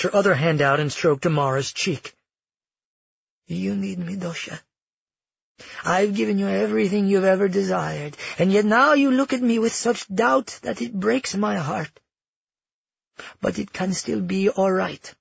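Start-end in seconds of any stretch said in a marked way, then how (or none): 3.5-15.1 higher-pitched, wounded appeal; coaxing grandmother. Acted. Grandmother wins back her doubting granddaughter, coaxing.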